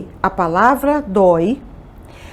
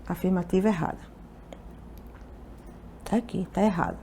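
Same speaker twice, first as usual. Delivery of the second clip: low, hushed aside while writing; talking to oneself; 4 semitones lower, 15 dB quieter, faster than usual. Real, not acted. Teacher noting the answer on screen, quiet aside. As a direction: say it softly, half to yourself.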